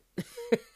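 short, high, loud laugh; self-aware laugh. Real, not acted. Host admitting she cried a lot, high laugh. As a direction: laugh at your own confession.

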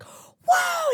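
high-pitched whooping yell; mock yell, way up high; imitated yell. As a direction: imitate a coach yelling into the phone. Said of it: "he just yelled into it".

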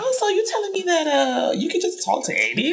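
high, fast disbelieving question; high incredulous mock quote. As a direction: act out shocked disbelief, pitched high. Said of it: "could be really like".

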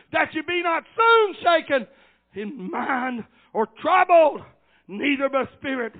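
loud, high declaimed Bible verse; forceful scripture preaching. Real, not acted. Preacher reading Thessalonians to the congregation, forceful scripture preaching. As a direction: proclaim the verse phrase by phrase.

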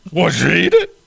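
loud rising announcer question; booming old announcer asking; Harry Caray impression. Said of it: "Will Ferrell used to do an impersonation of him".

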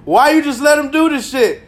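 high, loud mock scolding; imitating a scolding friend. Friend scolding him about the snake, loud and high. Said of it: "somebody was like".

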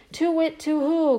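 high, ringing owl call; loud owl hoot. Acted. Owl hoots loudly to scare the weasels, high ringing call. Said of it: "called Wise Owl loudly".

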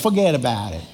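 low New Jersey "Forget about it"; New Jersey accent. Acted. King repeating the line in a New Jersey accent. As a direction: toss it off, pure New Jersey. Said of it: "The king was from New Jersey".

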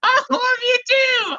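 silly squeaky fish lover; helium voice. Acted. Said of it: "it's the helium".

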